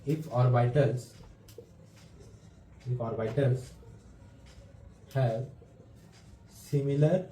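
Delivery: word-by-word dictation with long pauses; measured teacher dictating notes, halting after each phrase. Real, not acted. Teacher dictating a definition for students to write down, dictation.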